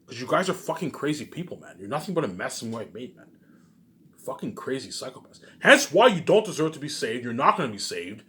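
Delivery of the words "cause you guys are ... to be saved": normal talking voice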